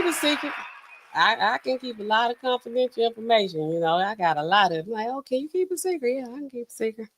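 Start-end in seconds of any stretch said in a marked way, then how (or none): none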